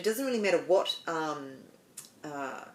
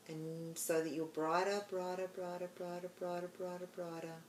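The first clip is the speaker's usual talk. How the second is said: soft, hushed meditation voice with long pauses; guided meditation; 10 dB quieter than usual. Acted. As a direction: ease the listener gently inward, much softer.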